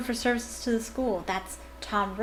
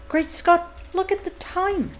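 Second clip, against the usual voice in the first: much higher, bright exclaiming; cheery Lockhart; 9 semitones higher, 3 dB louder than usual. Acted. Teacher surprised at the hour, high cheery exclamation. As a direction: gush like a vain celebrity.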